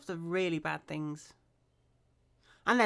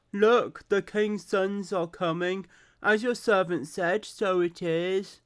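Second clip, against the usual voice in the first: put-on Brummie accent; 6 dB louder than usual. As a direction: read Jonadab's lines in put-on Brummie. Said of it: "I'm doing the stereotypical Brummie accent".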